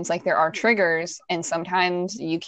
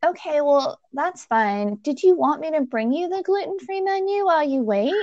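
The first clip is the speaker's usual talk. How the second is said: higher, mock-innocent waitress voice; chirpy clueless server, lightly mocked; waitress offers the gluten-free menu again, mock-sweet; imitating the waitress; 8 semitones higher than usual. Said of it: "And she said".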